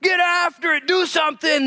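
high, loud, slow shouted command; tough dad. Acted. Preacher voices the Lord as tough dad telling believer to act, shouting. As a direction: bark it like a coach-dad pushing his kids. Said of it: "The toughness of a dad".

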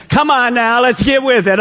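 loud, high southern rallying call; rally the crowd in a southern accent. Acted. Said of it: "get your southern accent in".

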